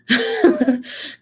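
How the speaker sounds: high-pitched giggle; laugh. Real, not acted. Laughing about cussing.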